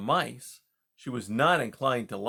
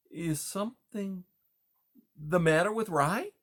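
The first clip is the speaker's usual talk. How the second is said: higher-pitched, halting little mouse; hesitant stammer; 7 semitones higher than usual. A narrator's reading. Timid mouse asks the beaver about Rye, stammering slightly. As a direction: falter mid-question, uncertain. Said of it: "Poppy stammered".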